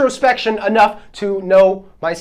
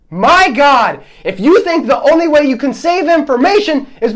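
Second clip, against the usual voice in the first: higher, exasperated scolding; heated, incredulous rant; 4 semitones higher than usual. Real, not acted. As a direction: tell them off, all fired up. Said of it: "Got a little heated there".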